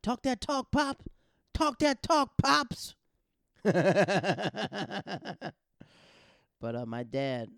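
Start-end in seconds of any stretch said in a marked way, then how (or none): 0.0-2.9 very high, loud hype-man cheer; hyped cheering. Retold or imitated. Brother cheers dad on after the sergeant, much higher and louder. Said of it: "my brother's like". 3.6-5.5 big real laugh; loud high laugh. Real, not acted. Narrator laughs at his brother's cheer, loud high laugh.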